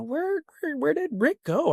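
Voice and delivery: high, anxious call into the woods; worried calling out. Retold or imitated. Retelling calling out for lost friend.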